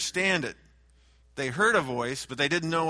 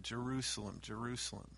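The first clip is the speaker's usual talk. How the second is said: a soft, hushed lament, much quieter; weeping lament; 3 semitones lower, 12 dB quieter than usual. Acted. Jesus weeping over the city, soft quiet lament. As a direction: mourn the name softly. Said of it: "cried and wept over Jerusalem".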